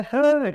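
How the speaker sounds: a stressed हे call. Shown as a demonstration. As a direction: punch हे to call someone. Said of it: "इस पे stress ज़्यादा कर दिया".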